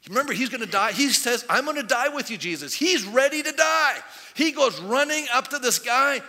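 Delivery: loud, high-pitched sermon storytelling; full-force preaching. Acted. Preacher retelling Peter's vow to the congregation, full-force preaching.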